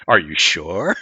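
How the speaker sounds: ominous teasing. Real, not acted. Teasing player about risky choice.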